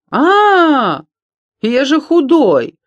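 high, loud yelp of protest; yelp, then wail it out slowly; alarmed cry. A narrator's reading. Доня, grabbed by the heel, protests being eaten, yelping.

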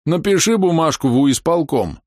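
high, slow pestering plea; wheedling. Acted. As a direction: nag like a pestering child. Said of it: "клянчил".

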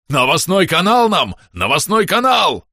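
excited loud yell. A narrator's reading. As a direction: bellow it out, high and loud. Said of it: "завопил Бом". (громкий вопль)

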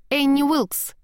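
called out with a slushy lisp. Acted. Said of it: "прошепелявил голос".

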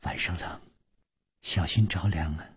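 softly telling him to keep warm; tender voice. A narrator's reading. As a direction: wrap him in the words gently. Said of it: "柔声说".

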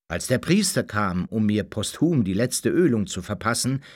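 plain reading voice

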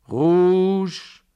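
very high, loud drawn-out call; long ringing cry; croupier's announcement. A narrator's reading. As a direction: sing out the winning colour. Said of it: "verkündete der Croupier".

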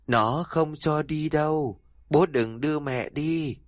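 put-on slow, hollow child's warning; eerie child. The girl Hân warning her father again, slow and eerie. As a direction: repeat the warning slowly, eerily. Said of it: "cái Hân lại lên tiếng".

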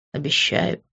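breathy whisper of a vow; tender whispered promise. A narrator's reading. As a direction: breathe the word close to her lips. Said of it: "прошептал он".

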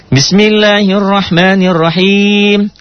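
slow, high chanted recitation; Quran recitation. Shown as a demonstration. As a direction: recite it in one melodic line. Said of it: "recites verse".

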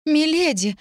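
высокий жалобный возглас; позови умоляюще, высоким девичьим голосом; мольба. A narrator's reading. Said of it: "затараторила она". (отчаянно взывает к госпоже)

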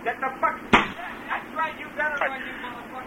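high, furious holler away from the receiver; angry distant yelling. Real, not acted. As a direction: chase them off, yelling from across the room. Chasing trespassers, away from the phone.

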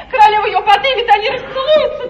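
agitated retelling. Acted. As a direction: tell it in rising agitation. Фрейлина взволнованно пересказывает. (взволнованно и громко)

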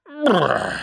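high, rolled lip-trilling brr; cat's shuddering brr. A narrator's reading. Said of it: "помотав головой".

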